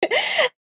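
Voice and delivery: high laugh breaking into tears of joy; tearful laugh. Real, not acted. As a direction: laugh and cry at once, overwhelmed. Crying with joy at acceptance.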